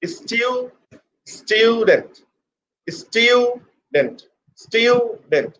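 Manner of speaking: STUDENT with a high, drawn-out STIU, then DENT dropping; stress on first syllable. Shown as a demonstration. Teacher showing what stress means, stressed high STIU-dent. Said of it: "Stress mean put pressure".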